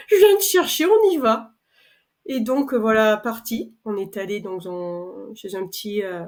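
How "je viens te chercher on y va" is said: laughing, high and loud burst of mum's excitement; imitating mum's eager reply. Retelling her mum rushing to come along, laughing and excited. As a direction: bounce up into mum's delighted yes, laughing.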